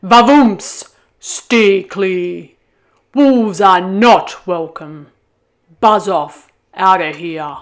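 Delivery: loud booming ram voice, slowing on the warning; bellowing ram. Acted. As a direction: roar the wolf away from the flock. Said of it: "bellowed Rambo".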